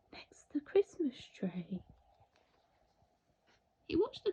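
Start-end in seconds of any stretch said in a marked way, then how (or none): 0.1-1.8 unvoiced, much softer hush; whisper. A narrator's reading. Narrator hushing beside the Christmas tree. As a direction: drop to a secret whisper. Hushed after climax.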